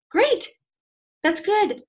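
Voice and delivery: high, bright mock cheer; sarcastic over-the-top cheer; mock excitement. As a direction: sell it like fake delight. Mock excited reply to lead.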